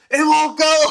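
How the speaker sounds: very high, loud mock despair; shrieky impression of a panicking friend; imitated desperate wail. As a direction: cry it out in hammed-up desperation. Imitating friend unable to clear smell.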